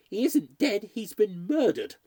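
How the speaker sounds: high, loud, agitated disclosure; trembling mistress. A narrator's reading. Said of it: "she was all trembling".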